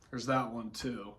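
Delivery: usual speaking voice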